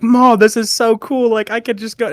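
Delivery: overflow with excitement to mom; freaking out. Retold or imitated. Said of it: "freaking out".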